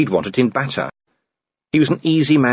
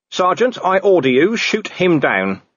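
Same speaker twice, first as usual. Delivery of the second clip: commanding; 9 semitones higher than usual. Acted. Vimes gives a fake order.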